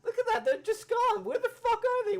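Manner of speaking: high, loud disbelieving shout at the screen; exasperated shout. Real, not acted. Shouting at the movie over the vanished extras, outraged. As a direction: yell in outrage that they vanished.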